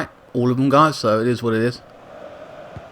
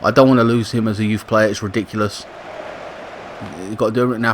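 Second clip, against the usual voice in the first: usual speaking voice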